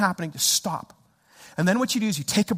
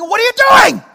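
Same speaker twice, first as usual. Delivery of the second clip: high, full-volume road-rage shouting; frothing rage; 11 semitones higher, 12 dB louder than usual. Retold or imitated. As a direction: yell furiously at the slowing car. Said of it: "I'm literally frothing at this point".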